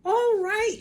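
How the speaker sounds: put-on very high, loud panicky old hag shout; shouting Grandma. A narrator's reading. Grandma panics and shouts for the fire brigade. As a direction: yell it like your stomach's burning. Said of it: "she yelled".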